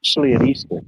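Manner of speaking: a breathy, unvoiced repeat of especially at Easter; half-whispered echo. Real, not acted. Echoing his own words to the friend, half-whispered. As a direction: say it again under your breath.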